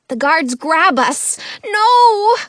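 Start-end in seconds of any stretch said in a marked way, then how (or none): 1.6-2.4 high loud cry; panicked shout. A narrator's reading. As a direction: scream it in panic. Grabbed by guards.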